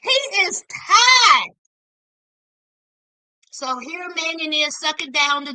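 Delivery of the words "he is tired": loud, slow, emphatic insistence. Real, not acted. To her audience about Anthony's state, slow loud insistence. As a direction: hammer it home slowly. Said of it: "Anthony is so tired".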